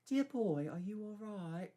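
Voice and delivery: put-on high, genteel old English lady; posh older lady. A narrator's reading. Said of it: "Doctor Babcock said".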